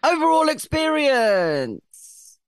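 very high, drawn-out section announcement; mock announcer. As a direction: sing out the category slowly and loudly. Announces next section in put-on voice.

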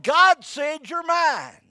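slow, high, drawn out; slow emphatic preaching. Acted. Preacher insisting to the church, slow and high. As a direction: stretch every word out to the crowd. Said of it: "I said".

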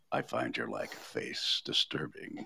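deep, quiet menacing villain; Darth Vader voice. Retold or imitated. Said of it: "his better line is".

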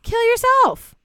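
shrieked high and loud; outraged shout. Acted. Host screaming at the murderer in outrage. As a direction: scream it at him. Climax of the rant.

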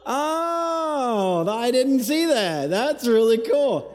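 high, slow wonder, drawn out; amazed realization. Retold or imitated. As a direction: gasp of discovery, then delight. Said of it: "step back and kinda look at the bigger picture and go".

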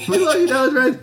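high-pitched adoring call, laughing; playful squeal of fandom; mock fan cheer. Co-host echoes a cheer for the weatherman, mock adoring.

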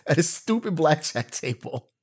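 mock outraged retelling to wife; mock rant. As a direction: whine about the lost money in a comic voice. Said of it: "I'm like".

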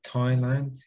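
normal talking voice

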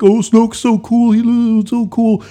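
loud, high mocking whine; mock the fanboys, loud and high, dissolving into nonsense; sneering parody of gushing nerds; whiny nerdy fanboy. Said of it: "nerdy fanboys were like".

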